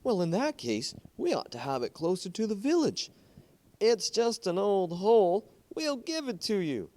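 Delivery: a villager jumping at the deal, pitched well up; eager villager. Acted. Villager eagerly offering the hole to the concessionaire, in a high eager voice.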